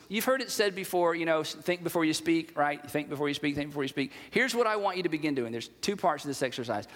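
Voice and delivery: normal talking voice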